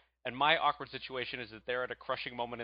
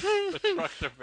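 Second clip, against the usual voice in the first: mock motto; 6 dB louder than usual. Mocking the Texan motto.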